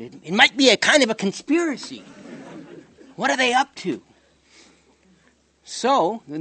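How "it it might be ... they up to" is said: higher, mock-suspicious conspiracy voice; Richard Pryor. Lecturer quoting Richard Pryor, higher mock-suspicious comic voice. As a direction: play it up like a comic smelling a plot. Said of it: "like Richard Pryor said".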